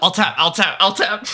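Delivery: frantic scramble. Acted. Teen hides the screen as dad comes in, frantic. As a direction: caught out and scrambling to cover it up. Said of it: "hitting the keyboard furiously, trying to hide".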